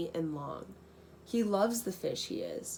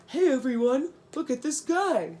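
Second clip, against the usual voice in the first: higher, louder excited call across the reef; loud cry; 6 semitones higher, 5 dB louder than usual. A narrator's reading. Little flounder calling everyone over, loud and excited. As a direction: shout it out to grab everyone's attention. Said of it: "the fish loudly cries".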